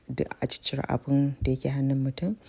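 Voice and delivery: ordinary talk, nothing marked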